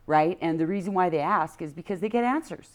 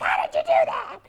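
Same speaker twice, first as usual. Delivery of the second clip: hammed-up husband in a much higher squeaky amazed voice; exaggerated husband. Husband marvels at her game-day luck, hammed-up high amazement. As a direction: overplay his amazement, pitch way up. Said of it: "he doesn't really talk like that. I exaggerated that".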